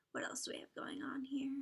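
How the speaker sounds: soft unvoiced whisper; whispered aside. Real, not acted. Whispering to herself while browsing a lair. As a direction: breathe it to yourself.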